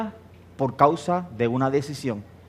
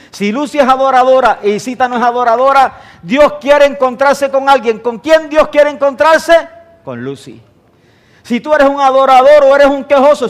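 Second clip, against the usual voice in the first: high, loud preaching, slowing on key lines; full-force preaching; 10 semitones higher, 9 dB louder than usual. Acted. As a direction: drive it home to the congregation. (predicación a todo pulmón, aguda y fuerte)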